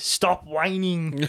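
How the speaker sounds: slow, drawn-out Austrian action-star command; Arnie. Acted. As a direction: order it in a put-on Austrian accent. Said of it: "one of them it just says".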